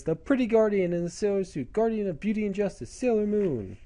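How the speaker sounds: high-pitched heroine's proclamation; Sailor Moon. Retold or imitated. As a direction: announce yourself as a magical-girl champion. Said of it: "when she says".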